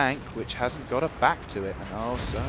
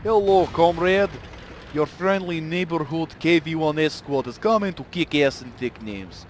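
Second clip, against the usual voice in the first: bad Russian accent, high and loud; crap Russian accent; 6 semitones higher, 5 dB louder than usual. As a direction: ham up a Russian tank commander. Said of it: "Sorry for the crap Russian accent".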